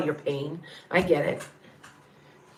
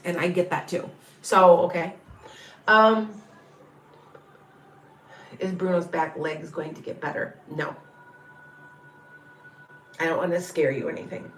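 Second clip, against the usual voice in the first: normal talking voice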